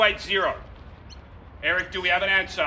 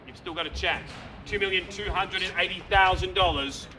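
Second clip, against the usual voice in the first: ordinary delivery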